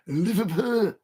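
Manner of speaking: tentative try at Scouse; attempted Scouse accent. Shown as a demonstration. Streamer asks viewers if it passes, tentative Scouse accent. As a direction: have a go at sounding like a Scouser. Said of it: "Is that a good Scouser's accent?".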